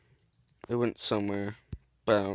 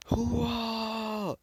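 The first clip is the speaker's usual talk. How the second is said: battle cry; 10 semitones higher, 3 dB quieter than usual. Retold or imitated.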